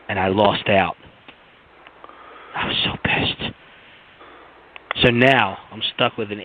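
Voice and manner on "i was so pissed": frustrated whisper. Real, not acted. Telling friends the box was gone, in a frustrated whisper. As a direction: whisper it, seething under the breath. Whispered aside after losing out.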